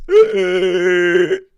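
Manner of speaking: wheezing asthma noise, loud; a hammed-up asthmatic wheeze; fake asthma wheeze. Shown as a demonstration. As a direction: pretend to have an asthma attack. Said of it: "That noise?".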